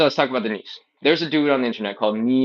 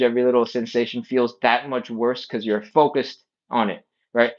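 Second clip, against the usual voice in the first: ordinary talk, nothing marked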